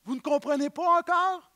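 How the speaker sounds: exasperated Jesus. Acted. Jesus to his disciples, exasperated and incredulous. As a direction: let the disbelief ring. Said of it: "il leur disait".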